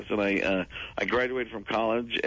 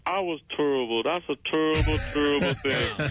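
a hint of Barkley, stretching terrible; Charles Barkley impression; 3 semitones higher than usual. Showing how Charles says terrible, lightly voiced. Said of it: "He says".

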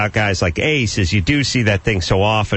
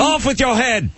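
mock royal decree; 13 semitones higher than usual. Mock Simon as queen.

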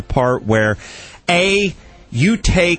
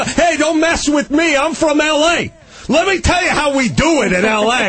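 hammed-up tough-guy brag; mock LA braggart; 11 semitones higher than usual. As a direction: puff up like an LA show-off. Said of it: "you know".

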